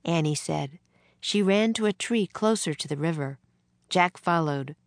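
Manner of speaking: ordinary delivery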